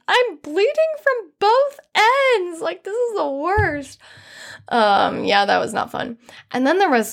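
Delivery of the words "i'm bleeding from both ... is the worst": much higher wailing, slow on the first words; half-laughing imitation of a crying wail, high; mock wailing. On the toilet with a bloody nose, mock crying wail, very high. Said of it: "started crying even harder".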